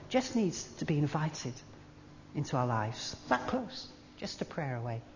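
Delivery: quiet tender invitation; gentle appeal. Real, not acted. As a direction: draw each listener close. Soft intimate sermon close.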